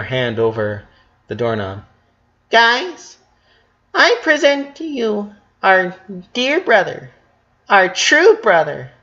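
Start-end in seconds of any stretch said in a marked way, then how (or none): 2.5-8.9 high, slow announcing voice; grand presentation. A narrator's reading. Leni unveiling their brother to the sisters, slow and high. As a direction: present him like a big reveal.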